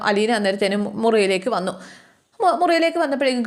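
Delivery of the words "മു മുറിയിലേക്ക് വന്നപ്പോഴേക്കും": normal talking voice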